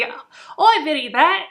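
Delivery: Cockney accent, pitched well up; mock Cockney. Shown as a demonstration. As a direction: do a playful Cockney lilt. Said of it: "almost like English Cockney".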